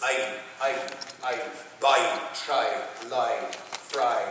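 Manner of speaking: "I", "buy", "try", "lie", "fry" with uh-ee vowel; Irish long I. Shown as a demonstration. Teaching audience the Irish "I", vowel as uh-ee. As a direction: drill each word separately with the Irish uh-ee. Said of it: "like a U H dash E E E".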